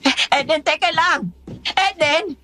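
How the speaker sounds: high, urgent call; worried call, rising in pitch; calling after someone. Acted. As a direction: call out to stop her leaving. Calling after her as she leaves.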